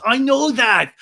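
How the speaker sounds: loud, high indignant retort; hammed-up defensive shouting; Sandoval. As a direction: imitate an indignant man, punching each phrase. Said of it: "And Sandoval goes".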